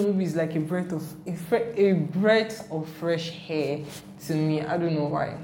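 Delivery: ordinary talk, nothing marked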